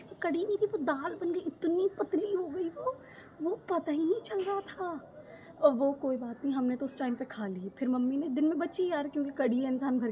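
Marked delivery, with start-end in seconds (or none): 0.0-5.2 mock dismay. Mocking mom's runny curry.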